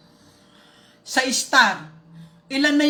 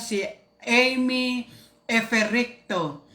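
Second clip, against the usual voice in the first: usual speaking voice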